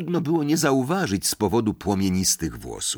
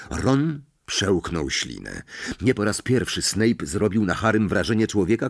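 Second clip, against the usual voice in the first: plain reading voice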